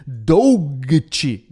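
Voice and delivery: every written letter sounded out, "Doggett" as dough-guh-tchee; naive spelling reading. Shown as a demonstration. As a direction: read "Doggett" slowly and loudly, letter by letter, Brazilian style. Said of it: "como é que fala isso aqui?". (leitura ingênua de "Doggett", letra por letra)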